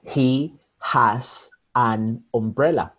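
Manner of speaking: "He has an umbrella" slow, each word separate; unlinked careful words. Shown as a demonstration. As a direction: pause between every word of "He has an umbrella". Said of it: "That's well pronounced".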